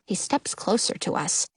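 plain reading voice

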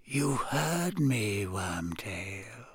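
slow, soft, fading command; hushed menace. A narrator's reading. Master repeating an order to his whimpering servant, hushed menace. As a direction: insist softly, drawing it out.

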